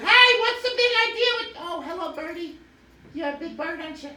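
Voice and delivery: very high, sweet-talking bug voice; high termite flattering the eagle; squeaky termite voice. Acted. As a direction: coo up at the bird in a tiny voice.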